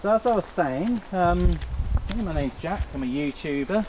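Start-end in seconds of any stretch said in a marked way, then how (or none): none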